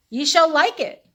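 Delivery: mock proclamation. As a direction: read the sign like a herald. Villagers' sign read out grandly.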